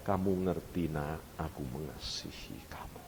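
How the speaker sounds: low, hushed, sinking to near whisper; tender fatherly voice. Retold or imitated. God asks his child to understand his love, tenderly. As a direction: murmur it gently, as to your child.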